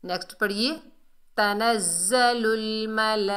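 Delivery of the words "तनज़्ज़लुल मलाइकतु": slow तनज़्ज़लुल, very long "laa" in मलाइकतु; long madd recitation. Shown as a demonstration. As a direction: chant मलाइकतु with the middle vowel stretched far. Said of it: "चार अलिफ की मिकदार खींच कर".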